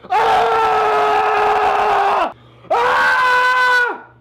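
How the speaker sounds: high screaming war cry; war face scream. Shown as a demonstration. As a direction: scream like a marine. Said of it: "Your war face!".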